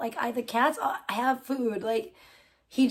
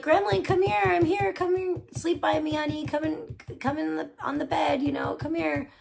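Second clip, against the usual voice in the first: higher sweet pet voice; coaxing pet talk; 6 semitones higher than usual. Retold or imitated. Retelling how she coaxes Gremlin to sleep by her, sweet pet voice. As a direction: coax the cat onto the bed like a baby. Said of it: "So I'll be like".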